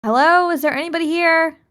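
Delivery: high, loud shout into emptiness; acted calling out. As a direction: call out for anyone who might hear. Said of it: "she's gonna kinda scream".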